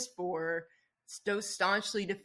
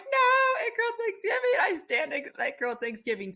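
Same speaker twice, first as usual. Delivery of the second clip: much higher, put-on voice, insisting; indignant defense; 12 semitones higher, 3 dB louder than usual. Host mimicking herself defending It Girl Thanksgiving in a high squeal. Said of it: "and I'm like".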